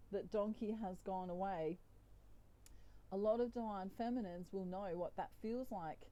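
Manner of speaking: usual speaking voice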